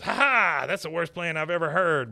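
loud, high derisive outburst; scornful mockery. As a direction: crow with contempt at the idea. Dale scorns Boomhauer's plan.

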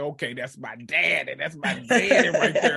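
slow, then laughing through it; mock-tender approval, chuckling; amused satisfied quote. Retelling seeing dad in casket.

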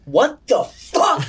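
drawn-out, outraged disbelief; losing it. Retold or imitated. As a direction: stretch each word in retold outrage. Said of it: "lost it on this guy".

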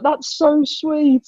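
high, slow, sweet imitated woman; Liv Tyler. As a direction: mimic her touched, gentle reply slowly and high. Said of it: "She was like".

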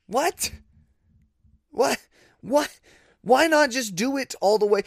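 high-pitched, baffled disbelief; incredulous. Real, not acted. Sports fan reacting to a half-done rule change, incredulous. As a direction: repeat it in squeaky bewilderment, then question it.

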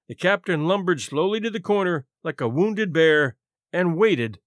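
ordinary delivery

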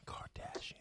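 breathy whisper of the name. Acted. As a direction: whisper "Kardashian" like a secret. Whispered repeat.

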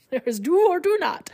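high, odd little Yoda croak. Retold or imitated. Quoting Yoda to the listeners, in a high put-on Yoda voice. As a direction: finish the line as Yoda. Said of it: "speaks in a very distinct way".

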